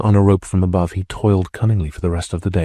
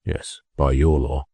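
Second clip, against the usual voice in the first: significantly; 5 semitones lower, 3 dB quieter, slower than usual. A narrator's reading. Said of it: "the terrorist replied significantly".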